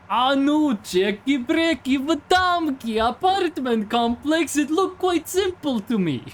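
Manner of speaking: high, slow, hammed-up Eastern European accent. Streamer does an exaggerated Eastern European accent for chat. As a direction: play it like a gruff Eastern European heavy with a cartoon accent. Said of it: "Let me try to be the heavy weapons guy".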